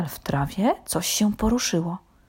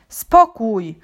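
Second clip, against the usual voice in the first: loud, higher call for calm; raised-voice horse silencing everyone; booming command; 4 semitones higher, 7 dB louder than usual. A narrator's reading. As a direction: boom it out with authority. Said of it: "huknął mądry koń Maurycy".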